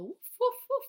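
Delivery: loud high woofs like a puppy; barking. Retold or imitated. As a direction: bark like a dog, high and loud. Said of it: "they'll go".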